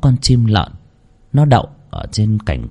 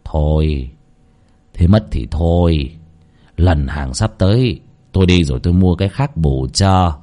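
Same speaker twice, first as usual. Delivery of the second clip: coaxing; 8 semitones lower than usual. A narrator's reading. Husband comforts his second wife, low and coaxing. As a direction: soothe her gently, unhurried. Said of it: "ông Chí giọng dỗ dành".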